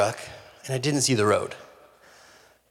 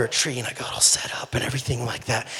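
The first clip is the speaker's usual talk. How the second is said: unvoiced whisper, a bit fast; 2 semitones lower, 7 dB quieter than usual. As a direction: whisper as if hiding from the turkey. Hunter hiding under a tree.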